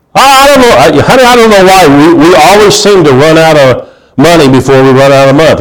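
high, loud, fretting voice of a broke husband; whiny complaining spouse. Pretend. Pastor mimics a broke couple complaining, high and whiny. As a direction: play the worried husband telling honey they're broke.

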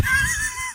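loud laugh. Real, not acted. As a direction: burst of high laughter. Laughing at own slip.

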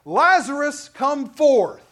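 loud ringing call; cried out. A narrator's reading. Jesus calls Lazarus from the tomb, loud cry. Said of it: "he cried".

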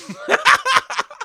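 loud high laugh bursting out; laughing off his guilt about mom. Real, not acted.

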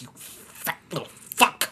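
unvoiced, slow whispered curse; whispered insult. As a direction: breathe it like a muttered insult. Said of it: "I'm like".

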